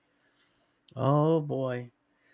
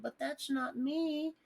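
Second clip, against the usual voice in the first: much higher and slow, prissy mock denial; mock listener voice; 10 semitones higher, slower than usual. Imagined listener denying it to him: high slow mock voice. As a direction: mimic a smug audience member protesting. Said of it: "some of you might think".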